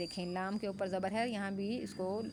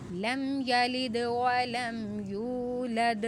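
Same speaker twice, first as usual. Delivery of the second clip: slow, raised Quranic recitation; 4 semitones higher, 6 dB louder, much slower than usual. Shown as a demonstration. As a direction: chant the verse slowly and fully. Recites the verse. (آہستہ بلند تلاوت)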